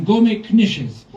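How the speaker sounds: high, loud and very slow command; gruff monster order. Acted. Panta Kozak sends the kids off to cook, loud and slow. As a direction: boom the order out to the children. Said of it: "he tells them".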